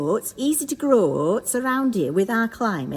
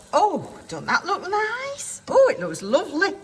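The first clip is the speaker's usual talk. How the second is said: delighted; 7 semitones higher than usual. Real, not acted. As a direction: coo over it with delight. Admiring the flipped oatcake.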